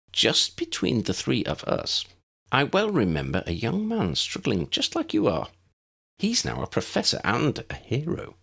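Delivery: high, bright little wizard voice, kept up; a small, eager professor speaking; tiny professor voice. A narrator's reading. Flitwick comforts boy.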